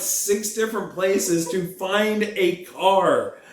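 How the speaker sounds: high, drawn-out exasperated protest; mock-indignant rant. Real, not acted. Reminding partner of the car-buying favour, mock-indignant.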